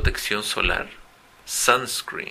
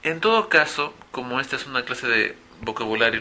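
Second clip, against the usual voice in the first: ordinary talk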